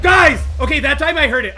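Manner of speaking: high loud cry to the viewers; startled shout. Real, not acted. Player calling out to viewers after hearing a creeper. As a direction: blurt it out in a jolt of fright.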